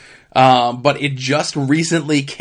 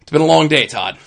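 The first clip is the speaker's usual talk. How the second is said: weary; 8 dB louder, faster than usual. Real, not acted. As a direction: complain it's been a long day. Weary complaint to cohost.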